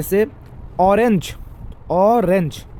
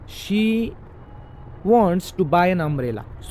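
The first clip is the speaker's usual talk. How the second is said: wants to buy an umbrella read very slowly; much slower than usual. Shown as a demonstration. Teacher slowly reads the example sentence to students. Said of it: "पहला वाला है".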